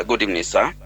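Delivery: ordinary talk, nothing marked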